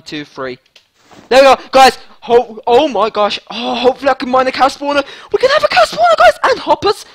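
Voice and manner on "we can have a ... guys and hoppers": very high-pitched giddy squeal; overexcited high squeak; giddy excitement. Real, not acted. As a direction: squeal it to your viewers, nearly laughing. Overjoyed at prospect of spawner.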